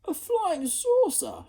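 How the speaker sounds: high, slow, loud gasp of wonder; boy astonished; amazed exclamation. Acted. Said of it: "exclaimed Ned".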